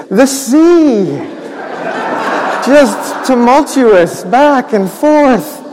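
put-on telling children how wild the sea was; dramatic storm telling. A narrator's reading. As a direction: let the sea heave slowly. Storm described dramatically for kids.

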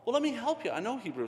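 the odd guy offering to teach Hebrew, high and eager; guy's eager offer. Retold or imitated. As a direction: jump in keen to help. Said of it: "And the guy goes".

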